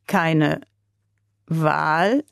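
"Keine Wahl" slowly, rising into a question on "Wahl"; slow puzzled repeat. A narrator's reading. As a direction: repeat it, trying to grasp it. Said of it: "wiederholte der Russe langsam".

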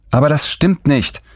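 put-on high indignant outburst from the girl; indignant protest. Girl contradicting Gutenberg, high and indignant. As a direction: object sharply, way up high.

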